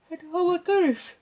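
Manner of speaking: words stretched through a yawn. Real, not acted. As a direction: say it mid-yawn. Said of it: "now I'm yawning".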